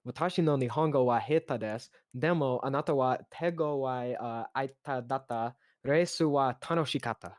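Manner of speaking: halting beginner Japanese with English accent; careful, hesitant Japanese in an American learner's accent. Real, not acted. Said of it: "my Japanese is not good".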